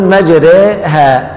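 "مجريها" very slowly, the ra vowel tilted toward e; slow teaching model of "مجريها"; drawn-out imala. Shown as a demonstration. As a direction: stretch "مجريها" syllable by syllable with a leaning ra. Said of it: "فنقول".

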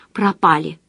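usual speaking voice

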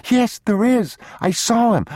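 high, loud insistence; crying little boy. A narrator's reading. Boy insisting to papa he saw someone, high tearful protest. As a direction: protest as an upset child. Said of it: "standing there crying".

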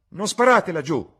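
high, full-force yell of warning; loud shout. A narrator's reading. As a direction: shout an order across a field. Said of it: "urlò a gran voce".

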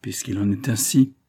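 plain reading voice

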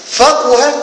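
فقه announced high as "faquha", with u after the qaf; middle vowel u. Shown as a demonstration. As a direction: call out فقه with a rounded middle u. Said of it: "وضم العين".

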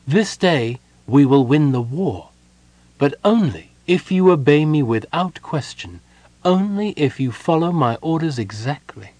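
solemn, measured rallying speech; commanding prophecy. A narrator's reading. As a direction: promise victory, demand obedience. Mina promises victory on conditions.

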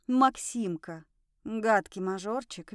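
plain reading voice

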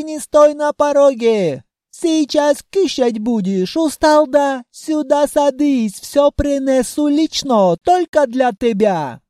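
put-on loud, high Caucasian-accented host; beaming welcome, loud and slow; joyful greeting shout. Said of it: "с радостным криком".

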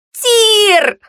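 very high, loud shriek. A narrator's reading. Girl screaming at the careering sled's driver, shrieking. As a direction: shriek the name in terror. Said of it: "визжала Фэю".

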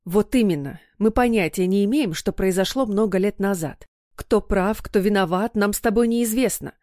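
usual speaking voice

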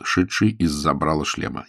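ordinary delivery, nothing marked

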